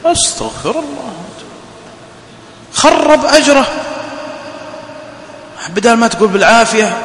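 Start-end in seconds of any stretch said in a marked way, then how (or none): 0.0-3.7 shocked exclamation. Real, not acted. Preacher shocked at the curse.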